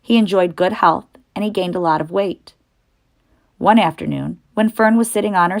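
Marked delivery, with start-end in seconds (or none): none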